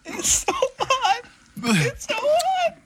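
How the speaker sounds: strained high squeak from the heat; squeaky high voice. Real, not acted. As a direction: squeak it out in pain. Pepper burning, squeaky high voice.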